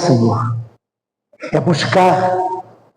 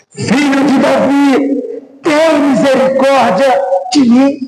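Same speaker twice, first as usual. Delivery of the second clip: crying out; 10 semitones higher than usual. Retold or imitated. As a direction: cry out for mercy in a raised pitch. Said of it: "Mas ele gritava".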